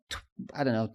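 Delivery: ordinary delivery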